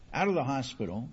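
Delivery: ordinary delivery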